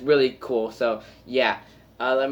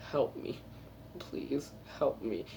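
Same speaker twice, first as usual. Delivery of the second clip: imitating crying plea; 14 dB quieter, slower than usual. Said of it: "he starts crying".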